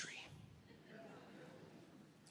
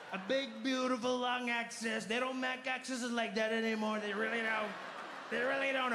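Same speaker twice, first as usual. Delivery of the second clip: Trump impression; 8 semitones higher than usual. Trump impression rant, alien reply.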